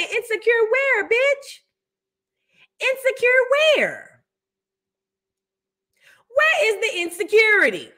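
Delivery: high, slow incredulous questioning; exaggerated disbelief, pitched high; mock disbelief. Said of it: "And you say".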